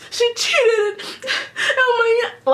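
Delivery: high, whiny boo-hoo voice; hammed-up whimper; mock crying. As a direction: imitate a crybaby wailing. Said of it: "boo-hooing because Kelly cheated".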